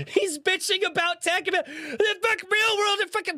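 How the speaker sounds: high-pitched, talking through laughter; laughing mockery. Real, not acted. Streamer mocking a tech-hating commenter, laughing as he talks. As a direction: crack up while getting the words out.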